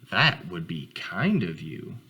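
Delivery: a proud father answering his son; deep calm fatherly reply; gentle father voice. Acted.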